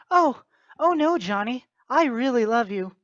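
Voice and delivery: high, prissy mock girl voice, hammed-up; mock girlfriend voice. Mocking the girlfriend as she fends off her boyfriend, high mock girl voice. Acted teen couple, girl's voice.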